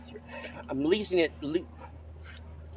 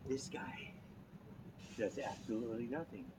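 whispered aside; 6 semitones lower, 16 dB quieter than usual. Real, not acted.

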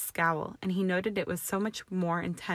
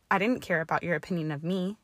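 ordinary delivery

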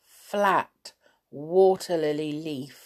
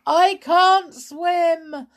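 high, loud, slow wail; panicked drawn-out cry; 13 semitones higher, 8 dB louder, much slower than usual. A narrator's reading. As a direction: wail helplessly, dragging each word. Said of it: "wailed Sailor".

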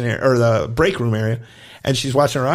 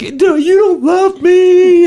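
put-on high, loud, slow wailing kid; mock tantrum wail from a heartbroken gymnast; child's tantrum; 11 dB louder, much slower than usual. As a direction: wail it like a kid sprawled on the floor. Girl on floor after being told no.

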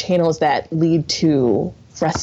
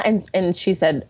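ordinary talk, nothing marked

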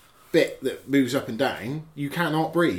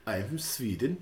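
put-on Swedish accent; 3 semitones lower, 6 dB quieter than usual. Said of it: "That was my Swedish accent".

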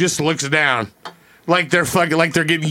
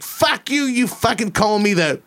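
higher mock rant; angry stranger cussing him out, mock and higher; retold going off; 7 semitones higher than usual. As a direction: imitate her going off, pitched high. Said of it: "starts going off like".

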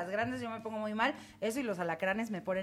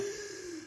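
gasp; 10 semitones higher, 10 dB quieter than usual. Retold or imitated. Sees a cockroach.

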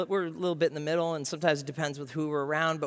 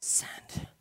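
whispered aside; 9 dB quieter than usual. Pretend. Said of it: "sending a text".

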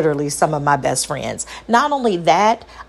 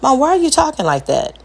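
put-on higher-pitched child questioning mom; lightly voiced, raised-pitch kid; puzzled child; 8 semitones higher, faster than usual. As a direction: imitate a kid puzzled by mom's odd tone. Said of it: "if I talk too low".

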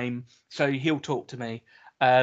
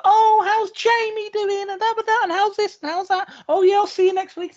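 sing-song wife; 6 dB louder than usual. Retold or imitated. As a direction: parody the wife's sweet chirpy greetings. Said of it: "she's like".

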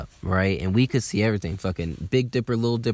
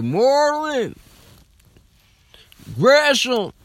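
much higher, louder, yelling the street names like a rap ad-lib; hype shout; 7 dB louder, slower than usual. Retold or imitated. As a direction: bellow them out like a hometown chant. Hyped shout of Atlanta streets.

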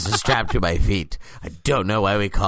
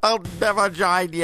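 much higher, mocking reply; mock heroic refusal; 10 semitones higher than usual. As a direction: squeak out the defiant hero's line. Answering the Vader quote.

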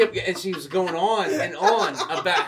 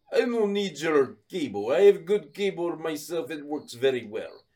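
put-on Russian customer; Russian accent. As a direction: refuse firmly in a mock Russian accent. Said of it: "this Russian guy".

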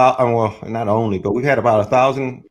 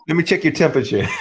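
laughing joke; 5 semitones higher, faster than usual. Real, not acted. Joking about checking for fever.

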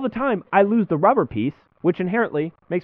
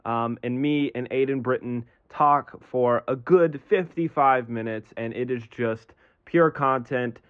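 announcer read; slower than usual. Acted. Recorded intro disclaimer read.

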